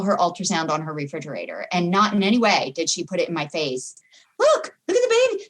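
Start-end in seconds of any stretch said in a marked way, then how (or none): 4.4-5.4 high, quick mock-excited voice; pitched-up gushing mom impression; imitating a proud mother. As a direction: play the friend pushing the ultrasound in her face. Mother showing off ultrasound.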